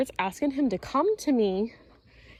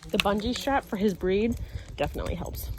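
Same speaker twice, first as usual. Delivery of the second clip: ordinary delivery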